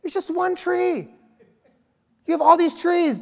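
high mock-scolding disbelief; exasperated at Adam and Eve. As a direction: scold them like they blew it. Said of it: "Have you ever had that thought?".

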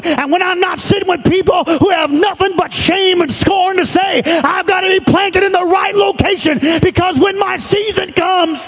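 high, ringing preacher's cry building to a shout; full-force preaching. Acted. Preacher driving his church toward the right place, full-force preaching. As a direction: preach it out over the congregation.

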